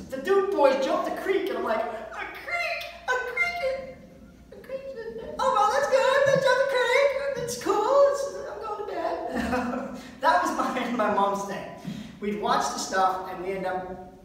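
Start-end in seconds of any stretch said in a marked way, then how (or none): 1.8-10.0 squeaky falsetto, half sung; hammed-up shrill wonder at a car jump; mock amazement. Son reacts to Duke boys' jump.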